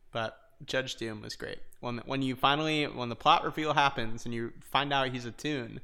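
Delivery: usual speaking voice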